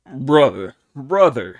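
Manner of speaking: loud taunting call; gloating laugh. Acted. Pig taunting Monkey, loud and gleeful. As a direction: crow it over him. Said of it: "Pig was falling about with laughter".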